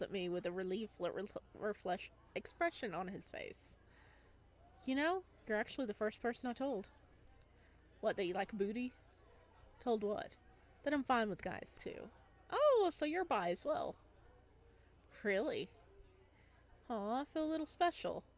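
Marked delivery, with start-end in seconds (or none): none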